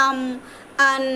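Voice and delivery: "আম, আন" each ending on a held, vowelless nasal; joined sukun consonant. Shown as a demonstration. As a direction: keep the final sound of "আম, আন" closed. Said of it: "তার মাখরাজে কি মিলায়ে দিই". ("আম, আন" শেষের অক্ষর মাখরাজে মিলিয়ে)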